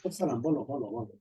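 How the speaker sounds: hushed voice on a call; quietly answering the phone mid-class; whispering on the phone. Real, not acted. Teacher takes phone call in class.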